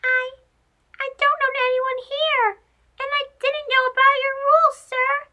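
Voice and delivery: very high, quick timid mouse voice; softly explaining mouse. Acted. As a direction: explain nervously like a small mouse. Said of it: "Chico explained softly".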